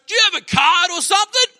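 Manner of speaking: loud, high shouted question; yelling out. Retold or imitated. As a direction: call it out from a distance. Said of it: "he yells out".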